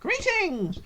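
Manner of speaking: high-pitched cheery gnome greeting; cheerful gnome. A narrator's reading. Gnome greeting the adventurer, cheerful high voice. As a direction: pipe it out high, like a little gnome. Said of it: "he says cheerfully".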